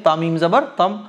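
ordinary delivery

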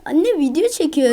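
protesting to mother. Real, not acted. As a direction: complain loudly at being interrupted. Protests at mother interrupting recording. (annesine yüksek sesle sitem)

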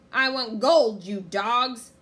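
very loud captain's bellow; greedy pirate outburst; angry pirate demand. A narrator's reading. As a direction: berate the crew at full voice. Pirate captain berating crew.

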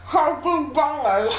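mushy words through a full mouth; barely shape the words past the grapes; cheeks stuffed full. Real, not acted. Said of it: "it's my mouth".

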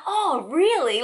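high, loud delighted question; light up with excitement; loud high excited imitation; excited friend. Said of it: "he's like".

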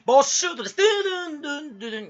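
high announcement into mouth-made jingle; game-show penalty call. Acted. Streamer plays quiz host and jingle, high mock announcement. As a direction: call it out high, then sing the sting.

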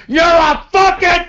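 cut-off angry yell; angry shout. Real, not acted. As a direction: snap at the caller. Cursing at a caller.

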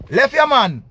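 loud, high burst; emphatic outburst. Real, not acted. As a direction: raise the voice sharply on a short exclamation. Mocking urging to leave partner.

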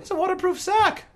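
very high put-on merchant voice; insistent shopkeeper. Shopkeeper justifying twenty gold for a sack, high insistent voice. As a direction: insist on it, pitched right up.

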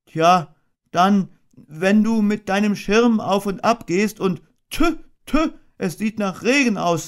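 a little bear anxiously asking, then shrugging; worried Pooh. A narrator's reading. Pooh plans with the queen bee.

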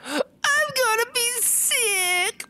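high, slow, mock crying; mock sick cry. Sick king cries out, high slow mock wail.

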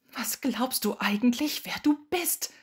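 outraged, loud and climbing high to the last word; indignant high-pitched outburst; furious, voice cracking. A narrator's reading. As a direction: let the angry question rise until the voice tips over. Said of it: "Ihre Stimme überschlug sich".

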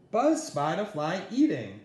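ordinary delivery

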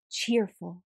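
usual speaking voice

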